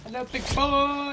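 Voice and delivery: high, slow, sultry purr; breathy vintage purr. Pretend. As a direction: purr it like an old-time vamp. Echoes flirt in put-on voice.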